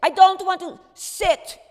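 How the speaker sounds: furious refusal. Retold or imitated. Miep shouting her refusal back at Otto, furious and high.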